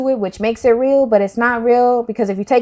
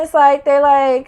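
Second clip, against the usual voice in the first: normal talking voice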